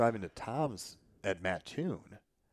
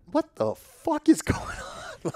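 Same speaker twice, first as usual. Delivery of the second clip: loud, high-pitched incredulous outburst, slowed right down; stunned disbelief; 7 dB louder, slower than usual. Retold or imitated. Telling host his reaction after puking, stunned disbelief. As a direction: replay your bewildered reaction, far higher and louder.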